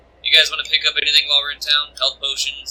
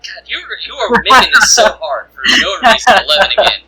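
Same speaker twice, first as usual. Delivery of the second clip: exasperated; 8 semitones higher, 7 dB louder than usual. Real, not acted. Player exasperated at the GM.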